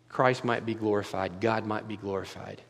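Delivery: ordinary talk, nothing marked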